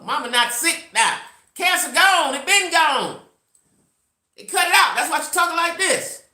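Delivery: high, loud exasperated insisting; exasperated shout. Retold or imitated. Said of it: "I said".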